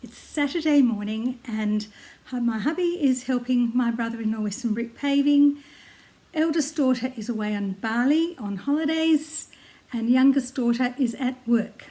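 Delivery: ordinary talk, nothing marked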